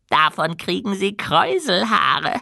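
high, squeaky goblin voice. A narrator's reading. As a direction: chatter like an eager little goblin. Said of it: "kicherte der Goblin".

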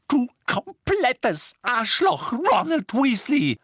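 high, slow enraged screech; shrieked insult with a blow on every word; furious, word by word. A narrator's reading. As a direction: hammer each word like a punch. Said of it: "Sie unterstrich jedes Wort mit einem Schlag".

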